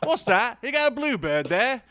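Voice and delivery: loud, high mock British dad; dad's British accent. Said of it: "representation of your dad's accent".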